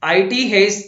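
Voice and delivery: आईटी spelled out as two letters, loud and high. Shown as a demonstration. Teacher showing that आईटी is read as letters, spelled out loudly. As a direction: say आईटी as the letters I-T, not the word it. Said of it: "ये it नहीं है".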